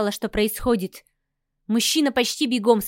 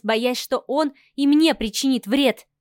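usual speaking voice

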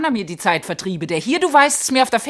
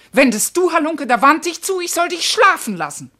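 louder, higher angry complaint; furious outburst; 6 semitones higher, 5 dB louder than usual. Acted. Karis scolding her husband for turning away, furious. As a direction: let the grievance boil over. Wife recounts husband's snub.

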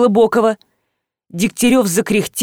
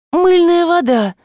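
ordinary delivery

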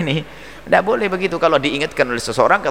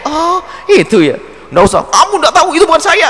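much higher, louder indignant protest; angry denial; 13 semitones higher, 4 dB louder than usual. Retold or imitated. A wrongly rebuked man snaps at his critic, high indignant protest. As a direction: bristle and snap back at the accuser. Nggak usah marah.

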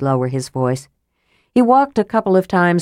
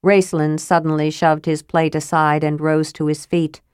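plain reading voice

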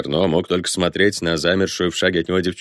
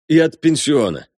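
raised, emphatic officer's statement; crisp, formal declaration; 10 semitones higher, 4 dB louder than usual. A narrator's reading. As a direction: add it firmly, pitched high. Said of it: "отчеканил Фогдахе".

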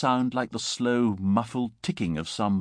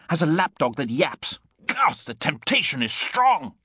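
impulsive excitement; 5 semitones higher than usual. A narrator's reading. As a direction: build into boyish, mischievous exasperation. Gibbon tempted to mischief, worked up.

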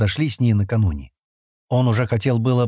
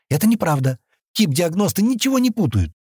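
higher-pitched protest; objecting; 7 semitones higher than usual. A narrator's reading. Kenni contradicting Sten about the diagnostic program, objecting. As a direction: push back with pitch rising well up. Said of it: "возразила Кенни".

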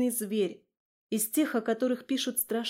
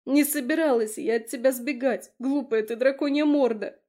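desperate; 4 semitones higher, 6 dB louder than usual. Acted. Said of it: "отчаянно отозвалась девушка".

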